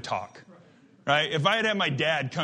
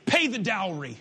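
demanding. Acted. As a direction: press the demand on a father, pausing between phrases. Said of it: "That's what he's demanding of his father".

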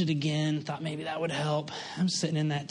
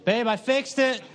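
proud announcement; 9 semitones higher, slower than usual. Retold or imitated. Triumphant announcement to wife.

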